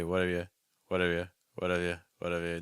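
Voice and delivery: "what have you" as quick, loose whaddav-ya; light contraction. Shown as a demonstration. Teacher drills students, "what have you" loosely contracted. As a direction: barely work the lips on "what have you". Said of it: "if you want the contraction".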